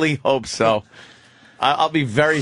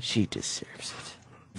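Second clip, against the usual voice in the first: whispered, much softer, slow; mock Mel whisper; 6 semitones lower, 15 dB quieter, slower than usual. Host voices the caller's late-night thought in a soft whisper. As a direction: breathe it out like a private vow.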